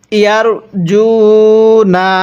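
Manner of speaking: ইয়ারজুনা drawn out slowly; slow recitation. Shown as a demonstration. Teacher demonstrates ইয়ারজুনা in recitation. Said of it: "কিভাবে পড়ব?".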